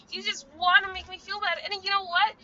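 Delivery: ordinary talk, nothing marked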